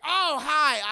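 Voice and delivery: high, loud startled greeting; startled kid. Retold or imitated. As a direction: jump then chirp it.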